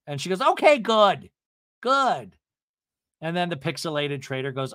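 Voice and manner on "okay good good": high, loud, punched acceptance; emphatic imitated approval; mock relief. Vicky told she is dead, mock emphatic relief.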